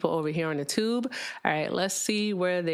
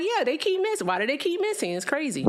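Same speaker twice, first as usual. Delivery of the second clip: much higher, mocking the caller's words; mocking echo; 10 semitones higher, 4 dB louder than usual. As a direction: parrot him in a high teasing voice. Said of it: "And I'm like".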